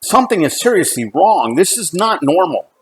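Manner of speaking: retold plea to be taken seriously; retold arguing. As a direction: press the point urgently. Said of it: "we had this huge argument".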